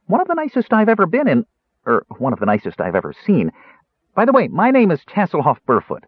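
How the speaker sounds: high, quick, guileless chatter; chatty Kender prisoner. Acted. Kender explaining himself to the jailer, chatty. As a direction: rattle on blithely in a high voice.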